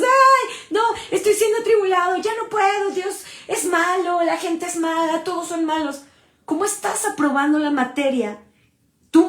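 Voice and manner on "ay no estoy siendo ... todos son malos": high, fast, whining self-pity; mock whiny complaint. Preacher mocking a complaining believer, high whiny voice. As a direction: whine like a believer who gives up under trial. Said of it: "Salimos corriendo y decimos".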